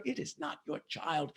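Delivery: plain reading voice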